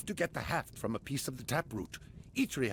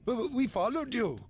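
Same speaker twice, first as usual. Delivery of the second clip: stuttering, higher and timid; nervous stammer; 8 semitones higher than usual. Acted. Child caught following the heroes, nervous stammer. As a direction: stammer out the confession.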